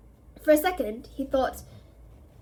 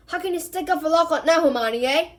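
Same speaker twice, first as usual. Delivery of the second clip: louder, calling out to her; calling through a curtain; 5 semitones higher, 7 dB louder than usual. A narrator's reading. Ron calls to Hermione beyond the curtain, louder. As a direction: call through the curtain, teasing. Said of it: "Ron called through the curtain".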